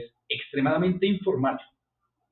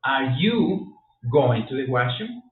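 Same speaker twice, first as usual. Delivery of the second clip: slow model question; slower than usual. Shown as a demonstration. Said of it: "La pregunta".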